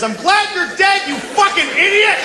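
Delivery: high, furious re-enacted yell; full-throated high shout of spite; yelling into woods. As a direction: scream abuse into the distance. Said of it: "I yelled into the woods".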